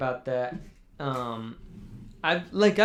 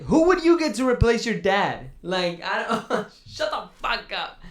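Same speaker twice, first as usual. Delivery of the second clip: incredulous retort; 7 semitones higher, 5 dB louder than usual. Retold or imitated. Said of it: "And I was like".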